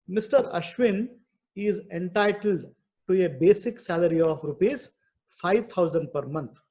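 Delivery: normal talking voice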